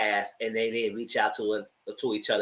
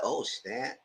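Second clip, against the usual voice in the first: breathy aside; 3 semitones lower, 10 dB quieter than usual. Retold or imitated. Reaction watching training.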